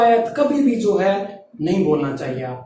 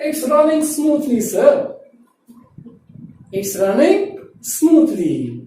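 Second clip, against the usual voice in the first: slow, drawn-out model line; slow model phrase; 2 semitones higher, much slower than usual. Shown as a demonstration. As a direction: lean on "running", slowly. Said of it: "teacher models the phrase".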